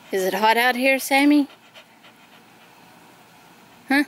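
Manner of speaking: ordinary delivery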